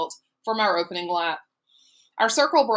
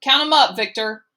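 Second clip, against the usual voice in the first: called out loud and higher; calling out; 6 semitones higher, 6 dB louder than usual. A narrator's reading. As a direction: call it to the back of the bus. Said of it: "looked in the rearview mirror and called".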